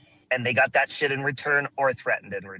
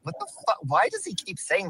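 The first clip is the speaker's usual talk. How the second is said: startled; 5 semitones higher, 3 dB quieter than usual. Real, not acted. Reacts to the repeated insult.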